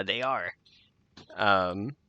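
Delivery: normal talking voice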